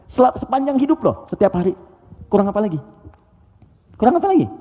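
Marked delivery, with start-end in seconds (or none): 4.0-4.5 much higher exasperated repeat; rhetorical exasperation. Real, not acted. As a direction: push the question up in disbelief. Repeats question more forcefully. (pertanyaan retoris yang jauh lebih tinggi)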